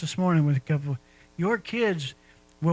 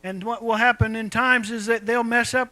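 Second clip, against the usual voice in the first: normal talking voice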